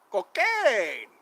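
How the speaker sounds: slow, loud, voiced-up punchline on cocaine; comic punchline about selling cocaine; deadpan punchline. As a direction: drop it like a joke reveal. Repeated joke punchline.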